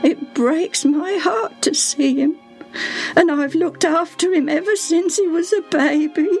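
high, sorrowful servant pleading; a grieving old servant near tears; voice breaking. Acted. Servant tells the visitor of the sick boy, voice breaking. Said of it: "Her voice broke down".

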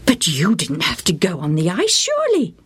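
rising mother's question; gently reproachful mother. A narrator's reading. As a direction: press him kindly.

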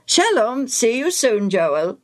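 lilting goodbye, high then falling; friendly farewell. Acted. Priest takes leave of Joel, friendly. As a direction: say a cheery human goodbye, dropping on the name.

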